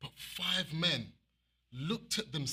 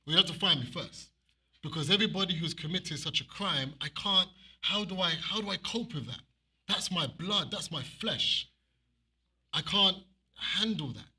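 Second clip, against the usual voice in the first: louder impassioned preaching, building; preaching with force; 4 dB louder than usual. Real, not acted. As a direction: thunder it out to the congregation. Rising preaching about the beating.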